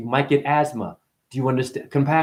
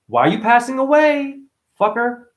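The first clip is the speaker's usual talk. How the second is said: mock outrage; 9 semitones higher, 3 dB louder than usual. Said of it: "I'm just".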